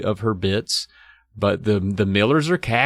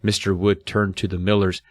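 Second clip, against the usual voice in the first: ordinary delivery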